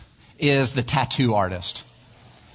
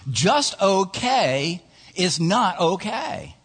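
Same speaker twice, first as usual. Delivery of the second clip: slow, higher-pitched, spaced-out slogan; emphatic refrain; 4 semitones higher, much slower than usual. Real, not acted. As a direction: stretch it out to drive the point home. Preacher drives home his refrain.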